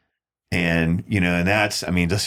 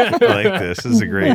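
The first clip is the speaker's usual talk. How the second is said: much higher and louder, delighted; excited; 13 semitones higher, 6 dB louder, faster than usual. Real, not acted. Cohost cheers on the billionaire story excitedly. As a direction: jump in with playful excitement.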